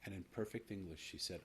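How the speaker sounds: ordinary talk, nothing marked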